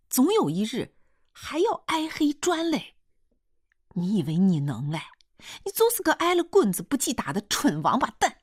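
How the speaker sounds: high, loud dialect tirade; furious woman hurling accusations; angry scolding. A narrator's reading. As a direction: berate them, building to an insult. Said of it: "继续骂".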